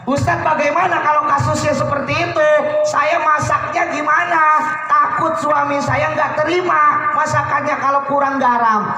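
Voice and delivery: worried housewife. Acted. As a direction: ask like an anxious wife.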